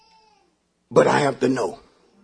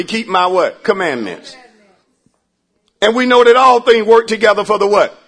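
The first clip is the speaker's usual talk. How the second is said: ordinary talk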